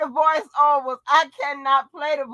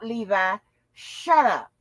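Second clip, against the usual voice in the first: ordinary delivery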